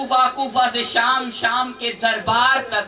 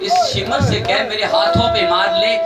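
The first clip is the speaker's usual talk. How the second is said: louder crying-out plea; loud grieving plea; 5 semitones lower, 5 dB louder than usual. Acted. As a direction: cry the child's plea out to the crowd, louder. Pleading child voiced loudly.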